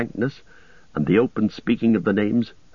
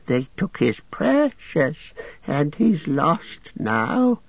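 much higher, slow on poor Smeagol; self-pitying creature voice; 6 semitones higher, slower than usual. A narrator's reading. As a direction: Gollum wallowing in misery. Gollum voice held.

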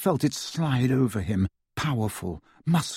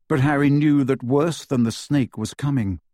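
ordinary delivery, nothing marked

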